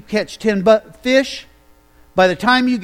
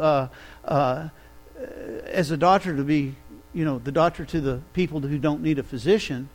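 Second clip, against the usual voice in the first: gentle reply; 4 semitones lower, 5 dB quieter than usual. Acted. Jesus gently answering critics.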